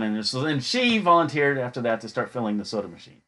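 normal talking voice

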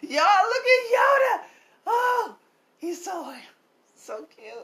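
high squeal of delight; gushing. Real, not acted. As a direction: squeal over something cute. Gushing over baby Yoda.

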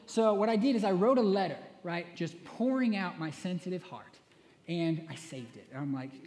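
ordinary talk

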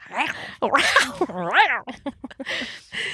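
mock wildcat roars breaking into laughter; playful wild growl. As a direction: let out silly rising and falling yowls. Acts out having gone wild.

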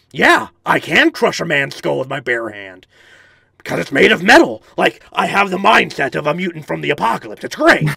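loud, powerful gravelly mutant; cocky gravelly swagger, punched hard; Harvey Barrel. Shown as a demonstration. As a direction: boast like an apocalypse mutant sure of himself. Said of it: "I feel very powerful, very sure of myself".

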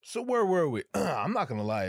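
ordinary talk, nothing marked

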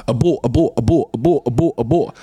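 loud insistent repeated command. Acted. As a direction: hammer it home like an alarm.

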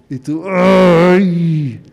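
drawn-out sigh of frustration; long exasperated ay. Acted. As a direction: let out a long, weary ay. (un ay largo y exasperado)

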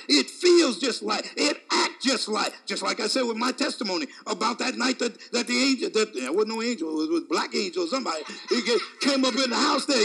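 normal talking voice

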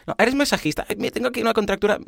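high, fast, gushing voice; eager chatty stranger. Pretend. Stranger pesters masseur.

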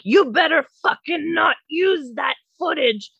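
high-pitched mock shouted threat; hammed-up indignant holler; imitated yelling. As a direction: yell a warning like the ranting neighbor. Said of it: "he's yelling at her".

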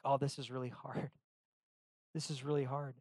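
soft, self-pitying whine; mock-sulk to himself; pouting. Pastor imitates himself pouting about hard days, softly whining. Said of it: "I'm kind of pouting".

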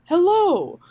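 drawn-out greeting, swooping high; high singsong hello to a friend; Michael Silverblatt. Retold or imitated. Friend spotting her at the theater, high swooping greeting. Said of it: "he was like".

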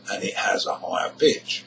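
usual speaking voice

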